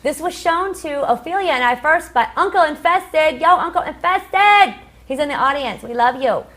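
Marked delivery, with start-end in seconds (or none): 3.4-4.8 calling out. Real, not acted. Shout-out to uncle in audience.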